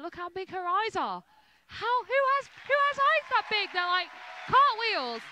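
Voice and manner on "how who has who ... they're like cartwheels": amazed. Real, not acted. Marvelling at a dancer's eyes.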